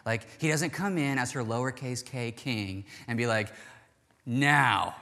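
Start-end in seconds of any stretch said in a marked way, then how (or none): 4.2-5.0 short, forceful imitation of a demand; mock demand. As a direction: act out a bossy king's order.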